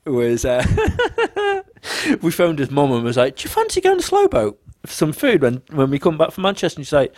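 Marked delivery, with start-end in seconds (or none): 3.4-6.8 high, lilting invite; put-on high-pitched friendliness; mock innocent invitation. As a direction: invite someone sweetly, voice pitched right up. Acted friendly invitation to mum.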